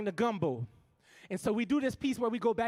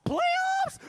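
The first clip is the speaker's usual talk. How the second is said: 'playoffs?' squeaked much higher and louder in disbelief; incredulous yelp; 7 dB louder than usual. Acted. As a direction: fire back 'playoffs?' as a stunned, shrill-free high yelp. Mocking football playoff talk.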